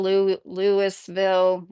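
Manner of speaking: Louisville slowly as LOO-iss-vil, S sounded; pronunciation variant demo. Shown as a demonstration. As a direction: pronounce the s in Louisville. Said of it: "there's literally signs that say".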